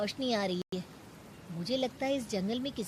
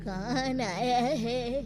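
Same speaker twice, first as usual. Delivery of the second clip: put-on slow, higher creaky old woman voice; slow old granny calling into the dark; 4 semitones higher, much slower than usual. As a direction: speak like an ancient crone in a dark hideout. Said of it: "एक बूढ़ी औरत मिलती है".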